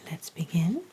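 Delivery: ordinary talk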